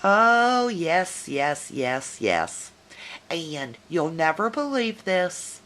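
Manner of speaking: ordinary talk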